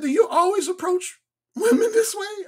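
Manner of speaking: high-pitched imitation of her challenge; incredulous woman. Woman questioning a man's blunt approach, incredulous.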